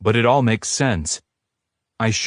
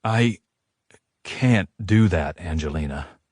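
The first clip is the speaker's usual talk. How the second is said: heavy; 2 semitones lower than usual. A narrator's reading. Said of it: "His voice is heavy".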